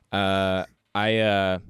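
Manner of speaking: ordinary talk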